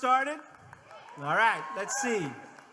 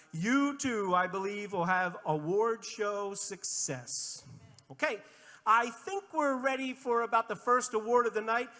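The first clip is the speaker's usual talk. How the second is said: ordinary talk, nothing marked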